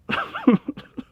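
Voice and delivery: a sudden high-pitched squeal of laughter. Real, not acted. Recalling screaming at night, high squeal of laughter. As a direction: let a shrill laugh burst out.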